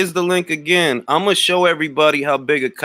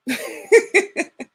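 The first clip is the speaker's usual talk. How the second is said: high laugh. Real, not acted. Mocking laugh at troll.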